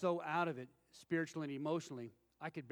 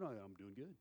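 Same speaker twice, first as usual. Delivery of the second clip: low, soft Clint Eastwood-style rasp; mock raspy reply; 7 semitones lower, 10 dB quieter than usual. Answering the front-desk lady, mock rough and raspy. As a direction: jokingly answer her in the same rough voice. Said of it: "I didn't really answer her like that".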